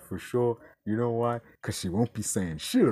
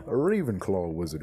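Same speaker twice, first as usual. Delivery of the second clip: usual speaking voice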